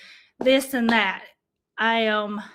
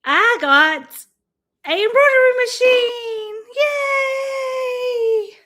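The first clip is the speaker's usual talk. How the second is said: high, loud, crying cheer; tearful joy; 11 semitones higher, 6 dB louder, slower than usual. Real, not acted. Streamer revealing her gift to viewers, crying out in joy. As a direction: burst out crying with happiness.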